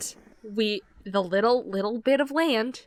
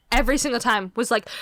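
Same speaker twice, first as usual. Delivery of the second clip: normal talking voice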